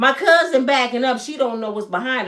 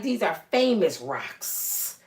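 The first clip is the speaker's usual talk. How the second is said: slow, reverent; 4 semitones lower, 5 dB quieter, much slower than usual. Retold or imitated. Teller quoting herself.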